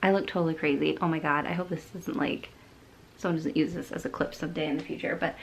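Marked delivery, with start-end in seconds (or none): none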